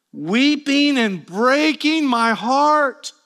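slow, high, drawn-out protest; acted heartfelt reproach; under pressure. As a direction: stretch it out as an anguished appeal. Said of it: "Is [name] feeling the pressure here?".

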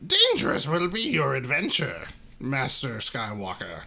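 mock Yoda wisdom; hammed-up Yoda. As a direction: lay on the Yoda. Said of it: "Frickin' Yoda".

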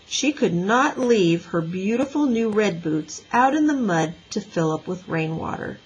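plain reading voice

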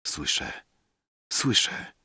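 very soft, trailing repetition; drowsy murmur. A narrator's reading. Poet half asleep answering Rafael, drowsy murmur. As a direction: murmur it as if dropping off. Dozing poet claims to hear.

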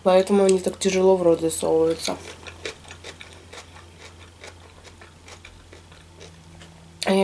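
speaking between bites; talking while chewing. Real, not acted. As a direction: mouth partly full of food. Seen 2: cheeks bulge, chewing motions between and during words at eating table.

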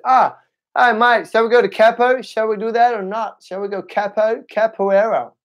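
high-pitched imitation of Australians; Australian accent. Imitating Australians inviting him to capo, high mock Australian accent. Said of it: "When I was in Australia, they, they said".

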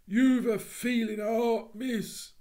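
a loud, cornered Cockney burglar. Acted. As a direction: plead like a cornered thief.

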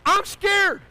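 high, loud frightened cry; scared yell. Retold or imitated. Tearful child to grandpa, scared yell. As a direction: blurt it out through tears.

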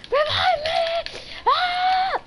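high, slow wailing shout for a revive; frantic drawn-out plea to teammate; desperate shout. Real, not acted. Downed player begs for revive.

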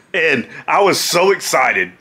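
ordinary talk, nothing marked

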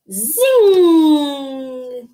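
long, loud, high "zing"; zinging sound effect. A narrator's reading. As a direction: let the zing ring out and glide. Story sound of flying off.

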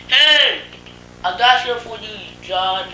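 loud, high shout across the room; yelled. Retold or imitated. Man yelling his brother's name to get his attention. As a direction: call him out at full volume. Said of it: "I yelled his name and said".

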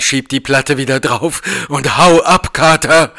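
laut werdender Warnruf; dringende Warnung. A narrator's reading. Eingeschlossener Gefährte warnt den Freund. (eindringlich warnend, am Ende lauter)

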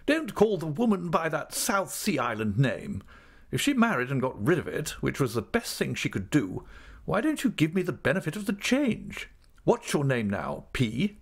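put-on high, imperious old-aunt voice; brusque aunt, exasperated. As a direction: complain briskly in a high, commanding voice. Aunt objects to the name.

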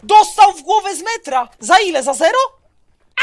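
loud, high, thrilled disbelief; excited shouting. Real, not acted. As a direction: yell it out in amazement. Hype over a huge headshot.

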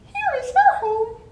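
very high loud chirping; baby bird voices. Acted. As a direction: squeak like tiny baby birds. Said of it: "the little robins".

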